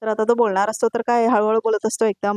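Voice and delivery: normal talking voice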